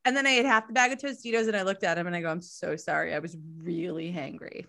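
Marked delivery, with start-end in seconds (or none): 2.3-4.6 sheepish apology. Retold or imitated. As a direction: lower and softer, sheepish sorry. Apologizing to partner after snack.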